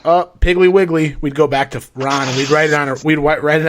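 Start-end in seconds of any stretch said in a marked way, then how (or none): 0.0-1.1 code phrase. Retold or imitated. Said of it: "we'd all be like".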